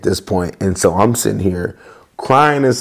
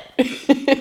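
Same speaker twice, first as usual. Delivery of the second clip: high burst of laughter; 4 dB quieter than usual. Real, not acted. Laughing after retelling.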